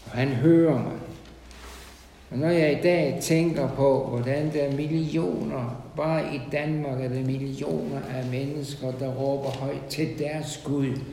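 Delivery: usual speaking voice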